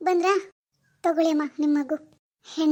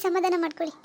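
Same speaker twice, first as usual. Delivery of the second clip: ordinary talk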